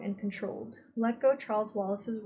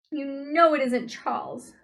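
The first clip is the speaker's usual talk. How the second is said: loud, higher angry shout; 5 semitones higher, 8 dB louder than usual. A narrator's reading. Meg shouting at the captors about her brother. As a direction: shout it at him in accusation. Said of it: "Meg shouted".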